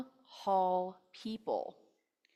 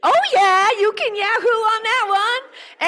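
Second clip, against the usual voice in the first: high, loud whoop of excitement; excited cheer; 13 semitones higher, 15 dB louder than usual. Real, not acted. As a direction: cheer it out to the crowd. Cheering after the promo.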